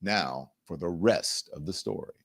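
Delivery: low, soft radio sign-off; Paul Harvey. Acted. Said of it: "as Paul Harvey would say".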